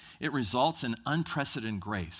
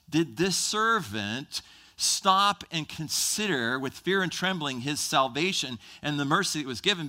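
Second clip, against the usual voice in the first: normal talking voice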